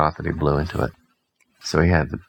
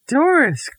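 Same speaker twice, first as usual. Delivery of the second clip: high, louder call of a name; 4 dB louder than usual. Acted. Mother calling Doris from the house. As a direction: call her across the yard. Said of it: "called Mother".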